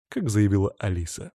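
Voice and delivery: ordinary delivery, nothing marked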